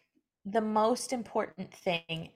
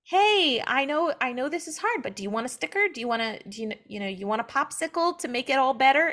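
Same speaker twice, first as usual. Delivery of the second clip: higher, brighter mock-soothing voice as if to a small child; mocking patronizing comfort; 3 semitones higher, 3 dB louder, faster than usual. Mocking a comforter who talks to a sufferer like a child, higher and patronizing. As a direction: offer the sticker like a condescending nurse. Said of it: "you know, like".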